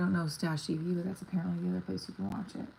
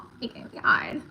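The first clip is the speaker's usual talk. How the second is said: put-on valley girl voice; 6 semitones higher, 8 dB louder, slower than usual. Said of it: "I sound like a five-year-old".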